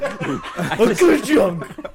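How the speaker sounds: drawn out laughing clunk; very slow printing press. Pretend. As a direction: stretch the ka-chunk way out, laughing. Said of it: "you've done that".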